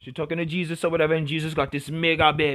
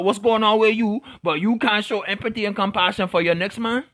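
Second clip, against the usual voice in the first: put-on talks it out in a higher, louder West Indian accent; 4 semitones higher, 4 dB louder than usual. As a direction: lean fully into a West Indian lilt while ranting. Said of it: "pardon the accent change".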